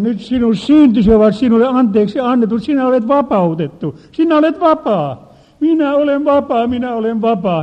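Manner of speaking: high, loud jubilant declaration of freedom; ecstatic proclamation. Retold or imitated. Preacher declaring the freed woman free, jubilant shouting. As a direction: shout it out, rising to triumph. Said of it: "Minä sanoin".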